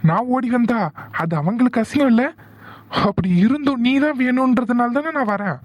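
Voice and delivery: high-pitched hurt anger, words tumbling out; angry outburst. Acted. Arjun to Sathya, stung by her family pride: high, hurt angry outburst. Lovers' quarrel, he feels insulted.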